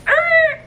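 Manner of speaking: sharp high mock dog yelp; puppy yelp. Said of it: "He's like".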